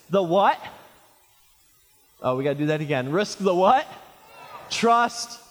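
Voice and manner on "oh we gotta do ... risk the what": usual speaking voice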